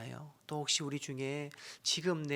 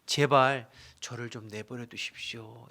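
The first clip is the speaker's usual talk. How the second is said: Job's plea; 7 dB louder than usual. Retold or imitated. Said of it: "이런 말이죠".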